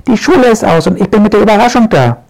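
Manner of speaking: high, fast, excited little witch; bursting to tell the surprise; girl excitedly announcing a surprise to her father. Acted.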